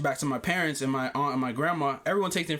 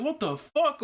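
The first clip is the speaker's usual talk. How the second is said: surprised what the fuck; 10 semitones higher, slower than usual. Retold or imitated. Startled by packed ER.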